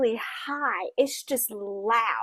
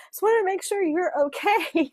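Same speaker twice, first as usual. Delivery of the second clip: high, slightly louder mock-panicked voice; retold panic; 8 semitones higher, 4 dB louder than usual. Boyfriend checking on her during the storm, retold panic. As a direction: imitate a panicking boyfriend, pitched up. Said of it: "he's, like, panicking".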